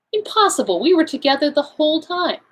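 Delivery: incredulous protest. A narrator's reading. Hastings disputes Poirot's claim.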